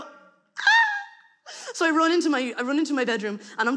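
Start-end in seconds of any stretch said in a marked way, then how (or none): 0.0-0.6 whispered startled gasp; a breathy panic squeak; panicked yelp. Retold or imitated. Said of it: "And I go".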